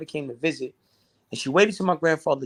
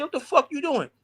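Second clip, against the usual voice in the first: high, sharp shocked cry; startled yell; 11 semitones higher than usual. Retold or imitated. Boy catching an intruder, high shocked yell. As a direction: jolt up in alarm. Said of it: "And I said".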